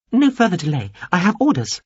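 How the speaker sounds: a small alien addressing Veppers carefully; high alien voice. A narrator's reading. Alien insists.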